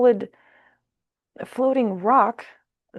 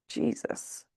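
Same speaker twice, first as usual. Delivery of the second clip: whispered Jesus; 9 dB quieter than usual. Real, not acted. Reacting to the cards.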